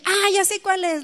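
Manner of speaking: high, delighted realization; excited recognition. Retold or imitated. Speaker recognizing the hymn Jamie describes, excited. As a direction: exclaim as the answer suddenly clicks.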